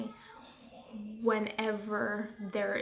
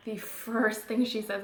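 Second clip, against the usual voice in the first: normal talking voice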